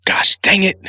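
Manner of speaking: hammed-up gosh dang; frustrated mock curse. Acted frustrated near-curse.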